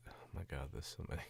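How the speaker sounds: ordinary talk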